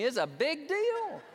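high, slow, drawn-out squawk; exasperated retort. Acted. Wife correcting her husband about surgery, mock exasperation. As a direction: stretch it out in comic exasperation. Mocks his own earlier claim.

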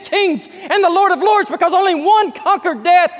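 loud, high fervent sermon; full-voiced revival preaching; preaching at full force. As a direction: proclaim it to the rafters.

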